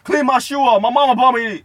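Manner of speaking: loud, high-pitched acted outburst; high yelled complaint; mock indignant shout. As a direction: holler it like an upset kid. Acted outburst about shoes.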